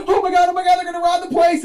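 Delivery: high, loud panicked shriek; mock terror at the masked pair; panicked diner.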